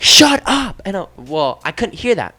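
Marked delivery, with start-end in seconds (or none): 0.0-0.8 higher, louder retold yell; mock shout. Friends yelling at him in the game, a higher retold shout. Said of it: "everybody's like".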